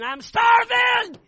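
hammed-up high, loud, slow kid's yell; the impatient son cuts in at supper with a shout; child's shout. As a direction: bellow it as a starving boy. Eight-year-old son interrupts.

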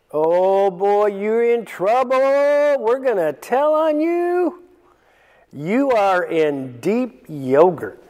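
put-on high, childish singsong tattletale; tattling servants. Other servants tattle to the master in a lightly voiced childish taunt. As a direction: taunt like kids telling on a sibling.

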